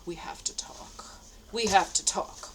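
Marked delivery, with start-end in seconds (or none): 0.0-1.2 whisper. Retold or imitated. Rereading his note, ominous.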